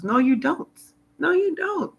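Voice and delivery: high-pitched contradiction, repeated; emphatic rebuttal. Real, not acted. Woman answering doctors who push drugs, emphatic high rebuttal. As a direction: pitch it up high to knock the claim down. Rebuts the quoted drug advice.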